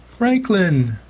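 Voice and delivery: high, desperate cry of a name. A narrator's reading. Mom crying out to her husband for help. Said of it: "she cried".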